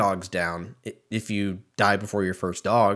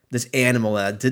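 normal talking voice

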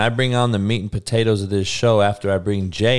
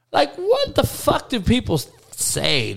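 incredulous; 13 semitones higher, slower than usual. Real, not acted. Incredulous rant about internet comments.

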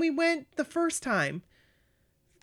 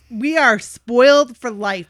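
loud and slow; 12 dB louder, slower than usual. Real, not acted. Emphatic declaration.

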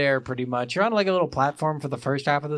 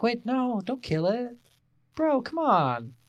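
pleading Ori; 8 semitones higher than usual. Acted. Voicing the game hero sparing an enemy, high pleading. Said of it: "And Ori's like".

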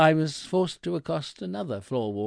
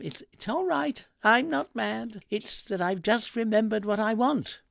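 high-pitched protest; 9 semitones higher than usual. A narrator's reading. Reassuring the detective who grabbed him.

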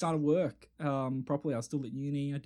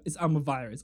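usual speaking voice

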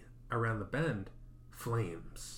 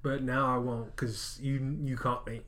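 casual ghost; 4 semitones higher, 5 dB louder than usual. Pretend. Ghost casually answering the victim.

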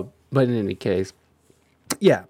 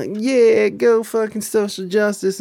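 mock activist cheer; 8 semitones higher than usual. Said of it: "she's, like, all about".

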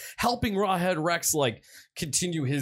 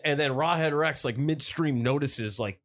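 usual speaking voice

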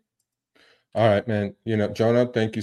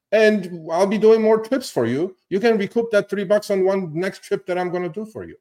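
exasperated plea; 9 semitones higher than usual. Retold or imitated. Driver's imagined demand to Uber.

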